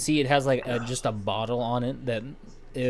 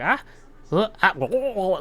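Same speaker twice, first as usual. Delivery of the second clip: gnome's groans; 8 semitones higher, 3 dB louder than usual. Acted. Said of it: "She's like".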